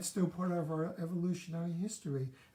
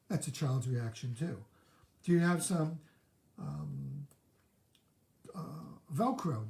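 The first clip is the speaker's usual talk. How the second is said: ordinary delivery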